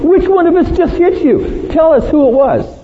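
high, sneering jeer at a prisoner; mocking taunt. Soldiers jeering at the blindfolded Jesus, high mocking taunt. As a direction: taunt him like a cruel guard. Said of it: "And they mocked him".

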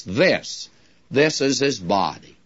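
this repeated and pressed, falling after; stress on this. Shown as a demonstration. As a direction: hammer the word this. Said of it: "puts the emphasis upon hoc".

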